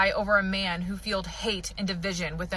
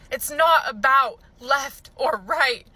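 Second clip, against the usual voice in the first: slow, higher, louder, each word hammered; emphatic repeat; 3 semitones higher, 4 dB louder, slower than usual. Real, not acted. Angry vlogger repeating her point, slowed and hammered. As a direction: pound out "not about left or right" word by word. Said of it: "I'm so fucking angry today".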